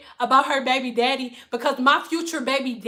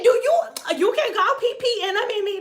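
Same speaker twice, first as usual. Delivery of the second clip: high client voice; 8 semitones higher than usual. Retold or imitated. Client mimicked.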